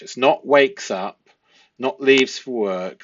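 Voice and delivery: ordinary talk, nothing marked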